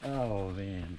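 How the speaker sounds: ordinary delivery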